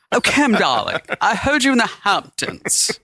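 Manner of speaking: high, drawling mock British socialite; hammed-up British Hamptons listener; posh British put-on. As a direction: gush in a fake upper-crust British accent. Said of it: "Is that what they're gonna sound like?".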